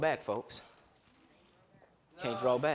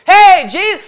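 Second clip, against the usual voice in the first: shouted high call to Jesus; loud cry out; 14 semitones higher, 17 dB louder, slower than usual. Acted. Blind Bartimaeus shouting for Jesus, high and loud. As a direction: call out to him with everything.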